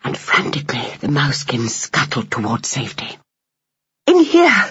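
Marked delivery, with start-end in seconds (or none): 4.1-4.6 high, loud shout of alarm; urgent cry. A narrator's reading. Mother mouse calling family into the straw bales, urgent cry. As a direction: shout them into hiding. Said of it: "cried Hyacinth".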